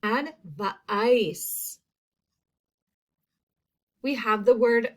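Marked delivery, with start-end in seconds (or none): 0.0-1.8 "Advice" sounded out as ad-vuh-ICE; long I sound. Shown as a demonstration. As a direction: draw out the second syllable of "Advice". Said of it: "Second syllable".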